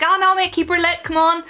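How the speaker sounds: high, loud coaxing plea; high pleading coax. Retold or imitated. Urging a friend not to leave.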